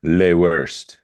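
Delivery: lightly put-on French accent; mock French. Joking about how they say it in French, mock French accent. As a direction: say it as if it were French. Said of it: "That's how they say in French".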